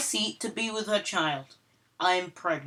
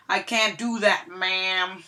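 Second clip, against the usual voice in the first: plain reading voice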